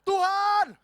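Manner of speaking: loud, high cry of "Tuhan!"; mock desperate yell to God; shouted prayer. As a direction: yell it so the people outside hear. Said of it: "Doanya teriak-teriak sekeras mungkin".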